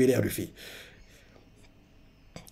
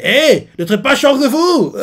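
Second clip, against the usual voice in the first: much higher, louder mock protest; Luffy protesting; 10 semitones higher, 5 dB louder than usual. Luffy scolds Zoro about Shanks, loud high protest. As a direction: snap back defending his hero. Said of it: "Luffy qui entend ça fait".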